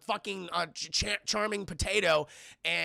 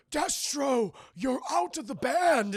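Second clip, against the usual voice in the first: lightly hammed villain; 7 semitones higher, slower than usual. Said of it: "He goes".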